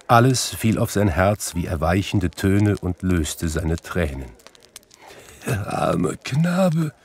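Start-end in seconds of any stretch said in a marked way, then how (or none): 5.5-6.9 high, slow, pitying lament; tearful. A narrator's reading. Scrooge pitying his younger self, tearful. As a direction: draw it out as if weeping. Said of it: "Und er weinte".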